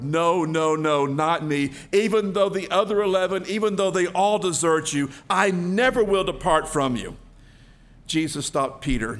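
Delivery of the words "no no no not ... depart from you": loud, insistent vow of loyalty; acted passionate denial; emphatic protest. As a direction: protest it forcefully, refusing to hear otherwise. Acting Peter's insistent protest.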